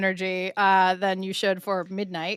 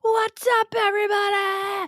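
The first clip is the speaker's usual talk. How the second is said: hyped shout; 11 semitones higher than usual. Retold or imitated. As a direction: shout it like a show host. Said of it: "the energy that you bring".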